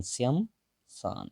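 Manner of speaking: "श्मशान" drawn out very slowly, every sound spelled out; slow careful pronunciation. Shown as a demonstration. Teacher shows the class how to say "श्मशान", very slowly and distinctly. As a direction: stretch out "श्मशान" sound by sound so students catch it. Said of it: "ऐसे".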